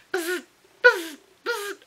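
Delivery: high vocal zaps; zap, zap, zap noises; buzzing laser imitation. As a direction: imitate a laser with short buzzes. Imitates the laser zapping inside.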